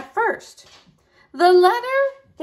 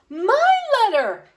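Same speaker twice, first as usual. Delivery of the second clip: insistent; 9 semitones higher, 4 dB louder than usual. Acted. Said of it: "insisted Hedgehog".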